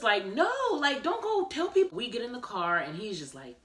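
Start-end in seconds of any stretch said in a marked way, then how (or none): 0.3-2.0 higher, urgent protest; mock frantic no; alarmed plea. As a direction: stop him in a panic. Stopping husband telling relative.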